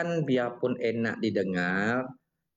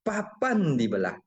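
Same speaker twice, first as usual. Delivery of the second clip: higher-pitched, declaimed verse line with emphasis; pantun recitation; 7 semitones higher than usual. Shown as a demonstration. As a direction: recite it like a performed Malay pantun. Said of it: "itu kan contoh kita bawakanlah pantun".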